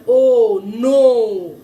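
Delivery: o held, then no blended loud and clear; teacher sounding out n plus o into no; sound blending demo. Shown as a demonstration. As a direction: stretch the o, then join it into no. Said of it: "ça fait n".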